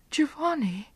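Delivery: crying out. Acted. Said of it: "Beatrice cried".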